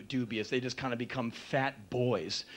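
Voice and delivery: ordinary talk, nothing marked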